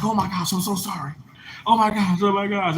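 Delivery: mock panicky high-pitched "oh my gosh"; flustered student apology.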